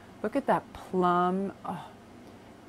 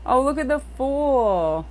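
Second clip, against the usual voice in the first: slow, high and louder, drawn-out wonder; delighted awe; 7 semitones higher, 5 dB louder, slower than usual. Real, not acted. Showing the Fool card to viewers, delighted awe. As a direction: gush over the card. Marvelling at tarot cards.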